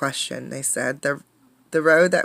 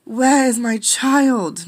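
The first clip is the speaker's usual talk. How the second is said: slow, loud, higher anxious cry; alarmed mother crying out, louder and higher; frantic cry; 8 semitones higher, 6 dB louder, much slower than usual. Acted. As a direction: call out in a panic for the missing girl. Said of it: "Miss Bobbin cried".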